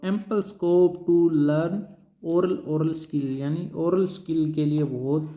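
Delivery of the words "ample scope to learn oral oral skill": dictating the heading at very slow pace; drawn out word by word for note-taking; teacher reading a heading slowly for notes. Shown as a demonstration.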